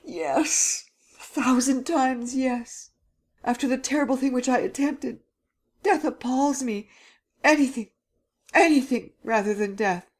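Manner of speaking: high, frantic desperate begging; Gérard begs Sernine in high terror; terrified pleading. Acted. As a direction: beg as if death is in the room. Frightened Gérard begging for life.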